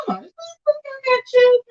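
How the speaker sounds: very high, upset pitch; upset, voice climbing far above normal; voice going high. Real, not acted. Looking up whether he has children, voice pitched very high. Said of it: "My voice is going high".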